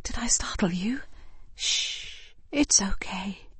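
unvoiced, gentle hush to a baby; calm the baby tenderly; soft whisper. Woman whispering to soothe a startled baby. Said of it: "I say in a soft voice".

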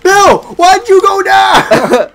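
very high, loud, drawn-out wail; anguished shout. Real, not acted. Player asking why the teammate went down, anguished wail. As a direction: wail at your fallen teammate.

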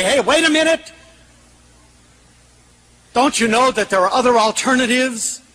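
high, urgent outcry short of screaming; mock scream. Speaker pleading with those who would give up, high urgent outcry. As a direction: call out in high-pitched protest. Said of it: "I want to scream and say".

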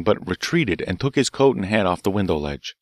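usual speaking voice

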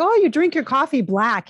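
lightly put-on New Jersey accent. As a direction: imitate a New Jersey guy remarking. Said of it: "He has a bit of an accent".

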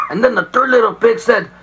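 ordinary delivery

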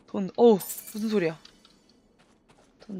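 ordinary talk

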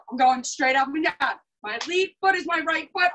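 normal talking voice

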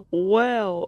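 normal talking voice

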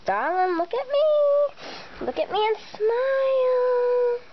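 drawn-out high coaxing; gushing photographer. Acted. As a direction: stretch it out and croon. Photographer pleading.

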